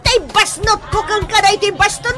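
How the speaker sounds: put-on loud, high, angry tirade at the drunk husband; furious scolding. Wife scolds drunk husband with cane. (napungtot nga ikkis)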